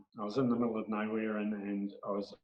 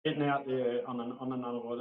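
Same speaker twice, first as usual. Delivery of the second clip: ordinary talk, nothing marked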